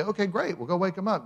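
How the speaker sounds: eager mock cheer; cheery disciples. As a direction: play it as happy, clueless disciples.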